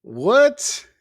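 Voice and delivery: high, loud, stunned what; real shock at the news; disbelieving what at a friend's first flight; surprised. Real, not acted.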